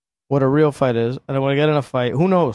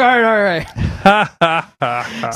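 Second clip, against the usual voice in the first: giving up; 3 semitones higher than usual. Retold or imitated. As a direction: tap out in words, caving fast. Beaten guy gives up under mount.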